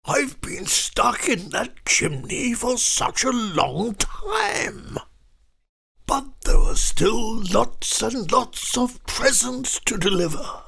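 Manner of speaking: high, soft, strained plea; Santa. A narrator's reading. Santa asks the witch for help with presents, high soft pleading. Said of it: "he said".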